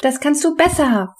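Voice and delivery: high-pitched urging; cheering on. A narrator's reading. Henrietta coaching Benny on the skateboard, cheering him on. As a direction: egg them on, pitched well up. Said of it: "feuerte ihn Henrietta an".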